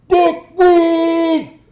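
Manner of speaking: very high, very loud bellowed name; furious drill sergeant yell. Retold or imitated. Drill instructor catching recruit smoking, furious yell. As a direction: blast it across the hall.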